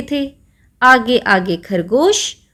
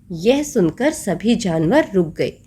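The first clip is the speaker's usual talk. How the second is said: plain reading voice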